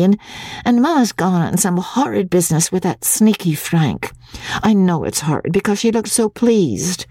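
high, breathless run-on nagging of a daughter; petulant, impatient girl; impatient complaint. A narrator's reading. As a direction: pile up grievances at Papa, pitched high. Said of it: "Magnolia exclaimed impatiently".

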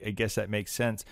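usual speaking voice

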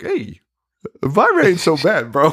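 a hammed-up admirer's voice; mock smitten guy. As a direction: play the smitten guy impressed by her looks. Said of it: "I was like".

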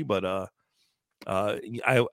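usual speaking voice

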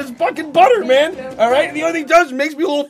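fake-furious tirade that can't stay mad; mock rant. Co-host asked to close the show with a hammed-up butter rant. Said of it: "just continue ranting about butter, please".

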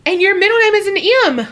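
high, loud, rushed exclamation; thrilled, racing outburst; excited amazement. Real, not acted. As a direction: blurt it out fast and high in disbelief. Excited reaction to name coincidence.

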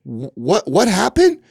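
high, stammered mock bewilderment; halting, then high rising disbelief; baffled question. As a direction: act out a startled question, pitch jumping up. Retelling his embarrassed, baffled question.

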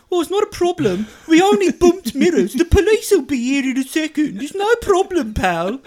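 high, loud mocking Birmingham accent; Brummie accent impression. The driver brushing off the bumped mirror in a Brummie accent. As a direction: ham up a smug Brummie telling him it's no problem. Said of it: "the way he kept going".

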